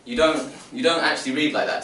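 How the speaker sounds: normal talking voice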